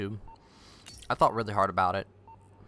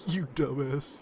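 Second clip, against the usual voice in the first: lightly put-on taunting voice; a hint of a jeering editor; teasing mock; 3 semitones higher than usual. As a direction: laugh at her while mocking. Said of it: "I'm sitting there editing, going".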